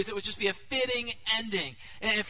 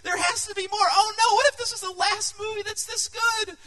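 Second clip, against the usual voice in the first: much higher and louder, rushing questions; anxious excitement; 11 semitones higher, 8 dB louder than usual. Retold or imitated. Pastor voicing fans' fear after the movie, high and loud.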